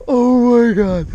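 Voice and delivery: overwhelmed by the fish; real awestruck gasp; stunned gasp. Real, not acted. Landing biggest fish ever.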